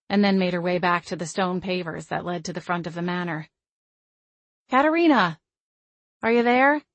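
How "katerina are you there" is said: higher, louder calling out. A narrator's reading. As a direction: call across the yard. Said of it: "I called out".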